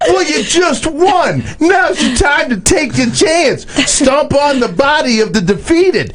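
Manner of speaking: high, slow mock taunt; lightly voiced smug winner, gloating; mocking wife voice. Mocking wife's victory, high voice.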